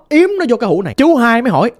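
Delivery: plain reading voice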